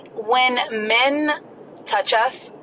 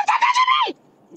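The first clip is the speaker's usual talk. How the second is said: very high shouted slower shriek; Kevin Hart shriek. Retold or imitated. Woman reenacting yelling at the man touching her, Kevin Hart style shriek. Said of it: "I hit a Kevin Hart".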